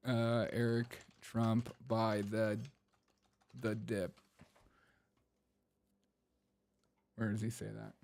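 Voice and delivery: fading mutter. Real, not acted. Host quietly muttering to himself while searching. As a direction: drop to a quiet puzzled mumble.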